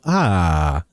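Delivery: long refreshed sigh, falling low; satisfied ah. Shown as a demonstration. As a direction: sigh AH as after a tasty soda. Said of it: "it tastes really good and you go".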